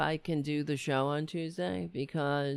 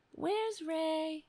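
high, soft calling voice; softly calling for the cat Ray; 10 semitones higher, 7 dB quieter than usual. Real, not acted. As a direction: coax the cat in a much higher voice. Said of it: "I'm trying to change the tone of my voice".

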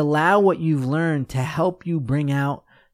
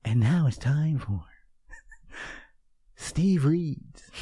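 toned-down announcer; 4 dB quieter, slower than usual. Pretend. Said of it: "Am I a little exaggerating".

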